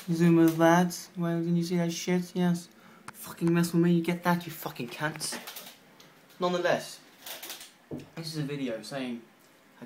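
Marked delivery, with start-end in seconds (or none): 0.1-1.0 excited call. Real, not acted. Excited at spider on camera. 1.2-5.4 mock taunt. Real, not acted.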